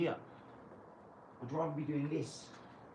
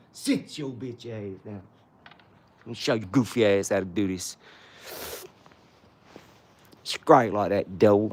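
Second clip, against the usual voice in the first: loud, lower, slow bellow; aggressive shout; 4 semitones lower, 13 dB louder than usual. Acted. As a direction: roar it at him. Showing off at the rival.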